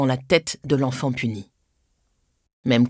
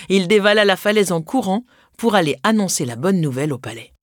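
usual speaking voice

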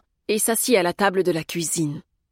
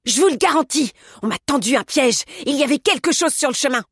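high, louder protest; worked-up, raised-voice complaint; indignant accusation; 7 semitones higher, 5 dB louder than usual. A narrator's reading. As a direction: insist angrily that you were set up. Postman angrily accusing after his fall.

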